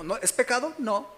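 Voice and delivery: usual speaking voice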